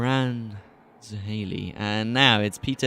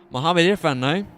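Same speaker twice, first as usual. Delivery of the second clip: excited call; 6 semitones higher, 5 dB louder than usual. Real, not acted. Charging commentary.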